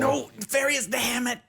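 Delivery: frustrated high protest; exasperated outburst. Acted. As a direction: cry out in dismay at a teammate. Exasperated cry at teammate's action.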